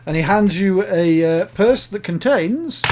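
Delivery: normal talking voice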